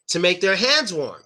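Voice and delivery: warm breath into cupped hands. Shown as a demonstration. Teacher showing a warm breath on cold hands. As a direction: breathe out warmly to heat the hands. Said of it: "Most people will go".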